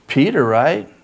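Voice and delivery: ordinary talk, nothing marked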